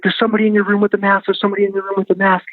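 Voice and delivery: high, very fast mimicked alarm; repeated plea tumbling out high and quick; retold tearful panic. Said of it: "like, crying at this point".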